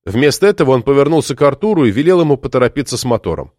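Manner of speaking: usual speaking voice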